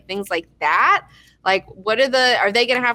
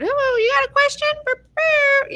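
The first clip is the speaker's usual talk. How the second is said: hammed-up squeaky cartoon helper, much higher; cartoon paperclip voice. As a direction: play a silly chirpy paperclip mascot. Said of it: "and he would".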